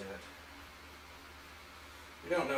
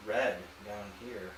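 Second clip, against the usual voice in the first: ordinary delivery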